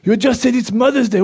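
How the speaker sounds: normal talking voice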